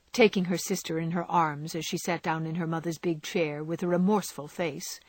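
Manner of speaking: usual speaking voice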